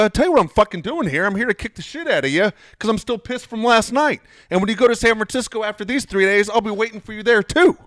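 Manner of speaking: loud, high-pitched angry tirade, retold; completely snapped. Threatening the catcher at batting practice, completely snapped. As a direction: re-enact an explosive threat at full voice. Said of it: "I completely snapped".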